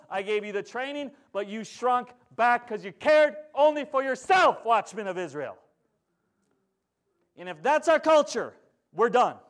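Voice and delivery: loud, high, impassioned rebuke; full-force preaching. Acted. Preacher rebuking the watchmen of Israel, loud and high. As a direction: thunder it from the pulpit like a judgment.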